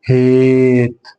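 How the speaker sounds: هات drawn out slowly as "haaate"; long vowel. Shown as a demonstration. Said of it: "الإيه تاخد الصوت الطويل". (هات بالصوت الطويل)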